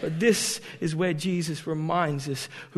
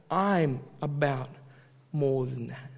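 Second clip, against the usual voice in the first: slow, softer and lower, I'm about more than that; quiet Jesus line; 4 semitones lower, 5 dB quieter, slower than usual. Acted. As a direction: drop it down gently. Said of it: "Jesus is saying".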